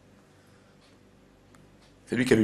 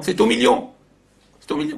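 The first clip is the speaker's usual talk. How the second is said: "million" pitched far higher, stressed; emphatic punchline; 12 semitones higher, 3 dB louder than usual. Shown as a demonstration. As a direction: drive home "C'est ton million".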